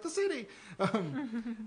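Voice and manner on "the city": high, louder relieved cry; joyful exclaim. Retold or imitated. Back from the sticks, overjoyed cry. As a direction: shout it like coming home. Returning to the city.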